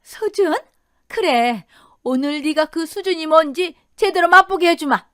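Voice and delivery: high, scornful mother-in-law; sneer, then rise into a loud threat; mocking, menacing scorn. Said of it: "그러자 그 여자가 비웃듯 말했답니다".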